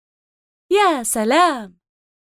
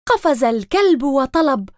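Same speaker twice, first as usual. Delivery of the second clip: usual speaking voice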